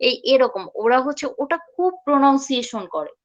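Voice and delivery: normal talking voice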